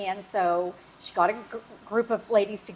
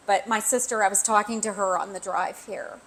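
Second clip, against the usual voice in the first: ordinary delivery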